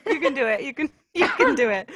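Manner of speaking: high, loud pep talk; real, emphatic encouragement; encouraging cheer. Real, not acted. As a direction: cheer them on excitedly. Cheering co-reader on to accent.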